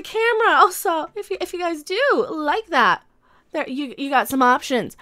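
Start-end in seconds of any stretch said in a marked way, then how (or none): none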